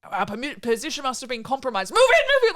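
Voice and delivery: frantic, rising to a high shout; laughing mock alarm calling move in; panicked soldiers.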